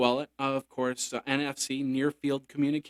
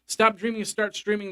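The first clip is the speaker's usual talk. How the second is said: ad slogan singsong; 6 semitones higher than usual. Acted. Sponsor slogan, sung out.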